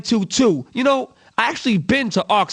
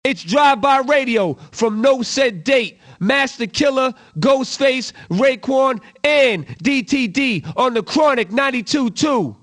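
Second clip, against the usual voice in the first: ordinary delivery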